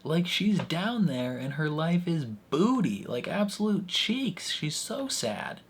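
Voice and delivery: high, slow, stretched-out mock rant with a laugh; exaggerated outrage. Imitating Mark's outburst about his wife's awful life, high and drawn out. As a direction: ham it up like a fed-up friend defending her. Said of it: "he's like".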